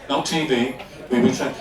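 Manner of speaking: ordinary talk, nothing marked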